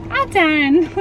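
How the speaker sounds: put-on high, light echo of the toddler. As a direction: mimic the toddler's "all done". Echoing the toddler's words.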